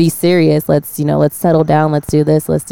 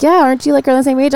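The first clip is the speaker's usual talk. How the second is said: put-on young man asking if she's his age, pitched higher; higher mock young guy; 9 semitones higher, faster than usual. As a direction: lift the pitch, playing the clueless young guy. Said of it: "he's like".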